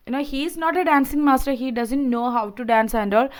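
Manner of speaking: ordinary delivery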